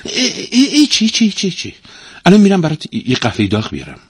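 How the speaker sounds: high, flustered stammer; quick, caught-out fluster; hastily. A narrator's reading. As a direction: stammer in a high voice, then rush out an excuse. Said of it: "زن به تندی گفت".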